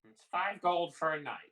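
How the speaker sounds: loud, lightly voiced old landlady; brusque barkeep. Old woman behind the bar quotes a room price, brusque. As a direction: call out the price curtly.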